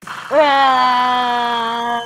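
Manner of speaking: loud startled scream; sudden shout. Real, not acted. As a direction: burst out with a yelp. Sudden yell in game.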